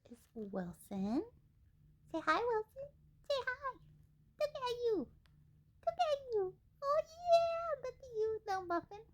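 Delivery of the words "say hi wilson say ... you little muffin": high cooing pet voice. Real, not acted. Owner coos high baby talk at her cockatiel. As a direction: coo sweetly up high at the bird.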